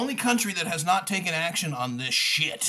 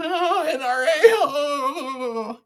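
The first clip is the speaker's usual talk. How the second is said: high, louder mock wail; mocking high cry of oh; mocking whiny politician; 10 semitones higher, 6 dB louder, much slower than usual. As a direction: sneer it out as a whimpering GOP stooge. Mocking NRA-backed politicians.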